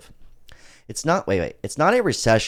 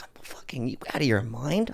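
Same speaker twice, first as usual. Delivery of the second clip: hushed, incredulous whisper; whispered disbelief; 7 dB quieter than usual. Retold or imitated. Asking his girlfriend if she's out of her mind, whispered. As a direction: breathe it out in shocked disbelief.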